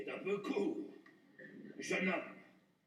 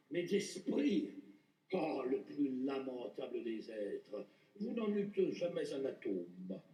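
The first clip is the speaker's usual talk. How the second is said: contemptuous; 8 semitones lower than usual. Acted. Scornful put-down of the vicomte.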